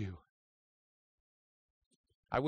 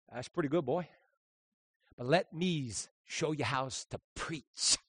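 put-on soft old preacher; 7 dB quieter than usual. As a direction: quietly put the young man in his place. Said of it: "as soft as he could".

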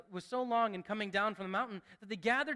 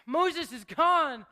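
louder, slow and higher; alarmed cry; 6 semitones higher, 8 dB louder, much slower than usual. Retold or imitated. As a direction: blurt it out in panic. People panic, Moses absent.

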